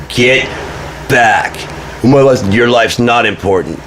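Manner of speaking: acted stern order. Acted lifeboat order to men.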